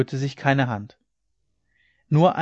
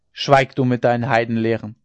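loud, stern rebuke; forceful silencing order; angry shout; 6 dB louder than usual. A narrator's reading. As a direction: shout him down with authority. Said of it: "schrie ihn Hauke an".

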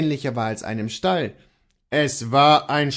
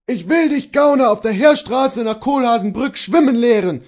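high, loud, raging threat; shouted threat; 10 semitones higher, 7 dB louder than usual. A narrator's reading. Bailiff threatens the servant with a beating, furious high shout. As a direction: roar a threat at the rogue. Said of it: "ruft der Schlossvogt".

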